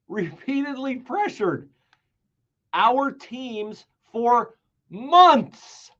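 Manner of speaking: high and slow, every word weighed; dramatic slow reading; slow emphasis. Shown as a demonstration. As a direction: linger on it for effect. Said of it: "every word you could pause over".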